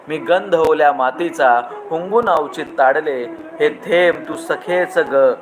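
lingering, lilting recital of verse; poem recitation. Acted. Host reciting a rain poem to his audience, lilting. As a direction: savour each line like falling rain.

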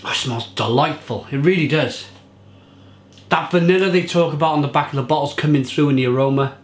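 normal talking voice

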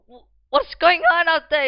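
high, very loud outburst at the noise; real startled shout. Real, not acted. Gamer reacting to a racket outside, loud startled shout.

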